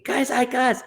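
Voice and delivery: high-pitched imitated squeal; retold excited shriek, not full volume; mock scream. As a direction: imitate a friend screaming in delight. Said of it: "and Dana started screaming".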